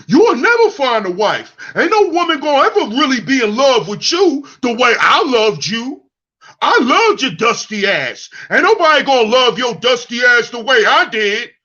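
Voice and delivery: mocking men insulting the woman who left them; mocking bitter ex. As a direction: yell it like a spurned ex.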